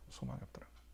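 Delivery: 소망이 없다라는 거예요 whispered, unvoiced. Shown as a demonstration. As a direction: breathe the line out as a whisper. Repeats line in a whisper. (속삭이듯)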